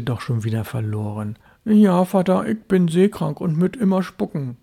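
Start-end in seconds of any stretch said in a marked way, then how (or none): 1.7-4.6 put-on high boyish voice, a little pitiful; a small boy owning up he's sick; seasick boy. Boy telling his father he is seasick, lightly pitiful.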